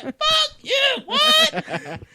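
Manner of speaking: high, shouting indignant man; indignant husband. Retold or imitated. Husband snapping back at wife, high indignant shout. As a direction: bristle at being called soft.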